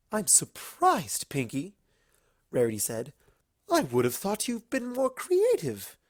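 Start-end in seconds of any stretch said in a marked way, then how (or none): 0.1-1.7 slow, high drawn-out refined voice; mild prim disappointment; lightly voiced Rarity. Rarity to Pinkie, lightly voiced and prim. 3.7-6.0 mild prim disappointment; lightly voiced Rarity. Rarity to Pinkie, lightly voiced and prim.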